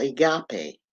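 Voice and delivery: agape in three syllables, "uh-GAH-pay"; agape stressed on the middle "GAH"; agape pronounced "ah-GAH-pay". Shown as a demonstration. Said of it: "But you pronounce it agape".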